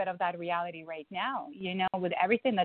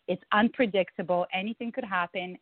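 usual speaking voice

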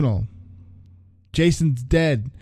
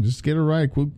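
ordinary delivery, nothing marked

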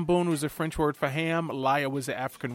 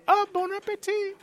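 much higher, louder, breezy Julia Child impression; imitating Julia Child; 5 dB louder than usual. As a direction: toss it off lightly the way Julia Child would after a tasting. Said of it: "She would taste and go".

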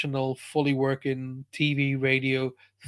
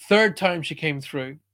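ordinary delivery

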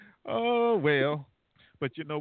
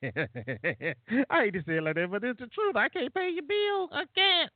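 laughing, rising much higher to a squeal; 8 semitones higher than usual. Real, not acted. Host teasing listeners about phone bills, laughing higher. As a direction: giggle the words as the pitch climbs.

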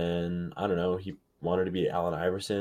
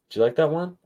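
proud of the pun; 9 semitones higher, 6 dB louder than usual. Real, not acted. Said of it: "I'm pretty proud of that one".

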